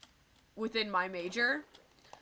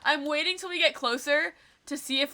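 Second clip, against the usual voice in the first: normal talking voice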